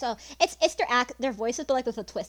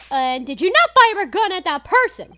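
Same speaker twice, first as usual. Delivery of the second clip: accusing; 8 semitones higher, 14 dB louder, slower than usual. Acted. Prosecutor presses the witness.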